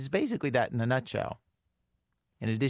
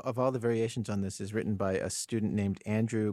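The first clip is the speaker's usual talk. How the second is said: usual speaking voice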